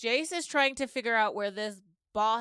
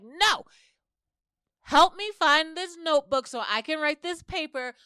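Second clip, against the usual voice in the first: mock insistent; 7 semitones higher, 8 dB louder than usual. Lori demanding help, freaking out.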